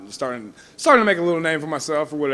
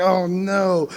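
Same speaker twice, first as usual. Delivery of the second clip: dismayed; 5 semitones higher, 4 dB louder than usual. Retold or imitated. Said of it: "I'm like".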